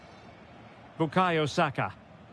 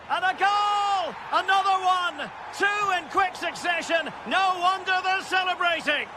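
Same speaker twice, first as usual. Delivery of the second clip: high, shouted sports commentary; excited goal call; 8 semitones higher than usual. Real, not acted. Commentator calling two goals in quick succession, excited. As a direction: cry out the goal and keep the pitch soaring.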